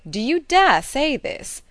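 high, loud, drawn-out challenge; affronted outburst; indignant cry. A narrator's reading. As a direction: cry it out slowly, leaning hard on DARE. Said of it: "cried Mister Knightley".

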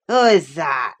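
loud sudden call down the stairs; sharp call. A narrator's reading. Drunk father calling down at a noise, loud and sharp. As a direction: bark it down the stairwell. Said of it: "he asked".

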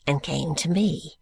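ordinary delivery, nothing marked

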